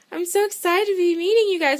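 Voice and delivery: imitating excited greeting. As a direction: gush it like a delighted hostess.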